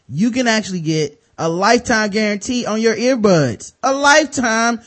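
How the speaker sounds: loud, high sales pitch; hyped ad read. Acted. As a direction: sell it like an infomercial.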